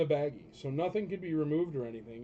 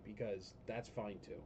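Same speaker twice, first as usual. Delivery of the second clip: dry, muttered sarcasm, much softer; sarcastic; 8 semitones lower, 13 dB quieter, slower than usual. Real, not acted. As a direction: drop it quietly, dripping with sarcasm. Sarcastic jab at complainers.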